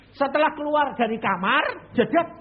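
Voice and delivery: loud, high jolt of realization; sudden shock. Acted. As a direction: hit the moment of shock. (terkejut keras dan tinggi)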